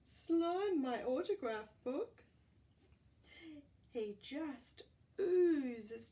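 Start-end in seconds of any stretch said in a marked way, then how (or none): 0.0-2.2 fan voice. Acted. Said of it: "Somebody over here is saying".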